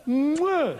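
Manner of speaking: loud mock air-kiss smack; a big loud fake kiss into the air; hammed-up air kiss. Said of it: "and go".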